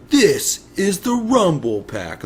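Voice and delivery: high, slow nerdy showman; dorky announcer voice. Retold or imitated. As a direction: present it like a dork, slowly. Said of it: "he's like".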